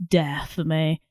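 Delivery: ordinary talk, nothing marked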